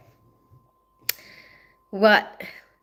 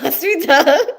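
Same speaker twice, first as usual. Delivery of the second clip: ordinary talk, nothing marked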